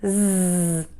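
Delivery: "Z" as a sustained buzz, zzz; voiced Z sound. Shown as a demonstration. Teacher demonstrates the voice box at work, buzzing "Z". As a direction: hold "Z" with the voice vibrating. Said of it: "voice box is on and moving and vibrating".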